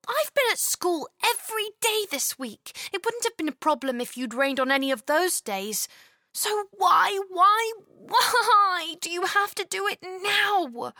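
put-on high, whiny child scolding the sky; sulky little girl. Chloe grumbling at the sky, high and whiny. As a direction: pout and complain to the rain.

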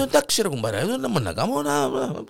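high, halting agreement; hesitant high reply. Acted. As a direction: agree reluctantly. (διστακτική συμφωνία)

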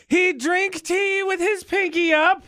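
posh British accent, high and slow, hammed-up; "He drink tea" in a high, slow, hammed-up British English accent; mock British accent. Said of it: "He be a Brit".

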